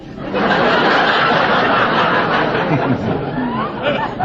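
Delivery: audience laughter. Real, not acted. Audience laughs at cemetery joke.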